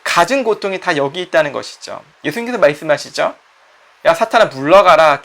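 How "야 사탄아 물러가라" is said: louder, forceful command; commanding rebuke. Acted. Jesus rebuking Satan, loud and forceful. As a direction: drive the devil away with one loud order.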